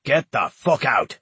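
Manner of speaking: slow, loud order through gritted teeth. A narrator's reading. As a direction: spit it out through clenched teeth. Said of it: "Between gritted teeth, Hawke ordered".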